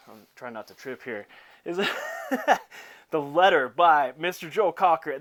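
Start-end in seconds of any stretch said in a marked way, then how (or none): 1.8-3.1 high-pitched burst of laughter; real laugh. Real, not acted. Host trying not to trip over the track title, laughing. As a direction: crack up mid-introduction.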